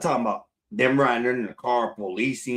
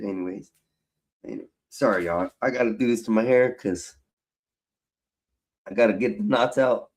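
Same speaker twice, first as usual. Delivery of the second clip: usual speaking voice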